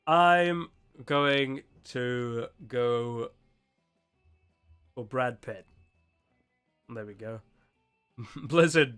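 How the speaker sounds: normal talking voice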